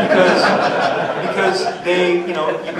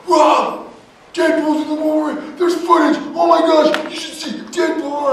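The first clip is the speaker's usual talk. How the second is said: high, loud, breathless fan yell; excited kids shouting; 12 semitones higher, 5 dB louder than usual. Retold or imitated. Kids bursting up to the table yelling about Deadpool. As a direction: shout it like an overexcited kid fan.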